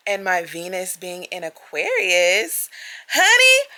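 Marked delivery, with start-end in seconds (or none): 3.1-3.7 excited honey. Real, not acted. Exclaiming mid zodiac rant.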